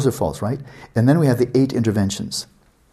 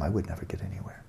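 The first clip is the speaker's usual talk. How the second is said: unvoiced whisper of giving up; defeated whisper; 3 semitones lower, 13 dB quieter than usual. A student muttering that he will never succeed, in a defeated whisper. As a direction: breathe it out, already beaten. Self-doubt fades to whisper.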